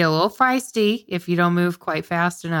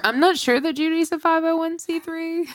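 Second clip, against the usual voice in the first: high incredulous voice; 11 semitones higher than usual. Acted. Joking high pitch disbelief.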